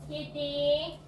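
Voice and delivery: pet talk. Real, not acted. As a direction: coo gently at the kitty.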